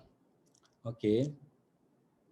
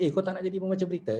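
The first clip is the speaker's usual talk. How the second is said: mock boss urging; 7 semitones higher, faster than usual. Boss urging pretty newcomer.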